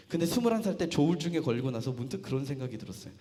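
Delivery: normal talking voice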